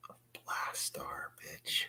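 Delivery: whispered reveal, hushed; dramatic whisper. Real, not acted. Collector whispers the character's name as a dramatic reveal. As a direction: breathe the name like a big secret.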